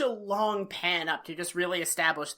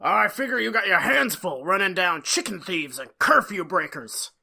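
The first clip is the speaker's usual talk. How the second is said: loud, blustering rich-villain voice; Sam the Eagle; 5 dB louder than usual. Retold or imitated. Rich guy belittles the small-town sheriff, loud put-on bluster. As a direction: put on a pompous, scornful big shot. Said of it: "he sounds kinda like Sam the Eagle".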